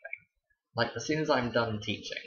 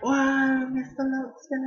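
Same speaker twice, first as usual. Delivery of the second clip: much higher and louder mock gasp of wonder; amazed kids; 6 dB louder, much slower than usual. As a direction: imitate the whole crowd of kids being wowed. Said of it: "all of the kids were like".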